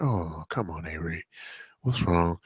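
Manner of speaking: dad gently soothing his crying son. Retold or imitated. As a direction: soften right down to comfort a crying child. Comforting crying toddler.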